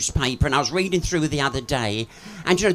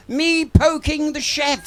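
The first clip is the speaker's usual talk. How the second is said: mock Chinese takeaway accent; 10 semitones higher, slower than usual. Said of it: "the person answered and he said".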